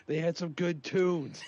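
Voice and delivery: high, slow, put-on sheepish voice; mock sheepish defense. Defending buying an ABBA album to a friend, slow mock plea. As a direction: plead it slowly like a caught kid.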